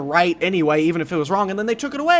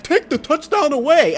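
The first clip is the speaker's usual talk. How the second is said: high, mock announcer call; 11 semitones higher than usual. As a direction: play the referee announcement. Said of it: "the announcer was all like".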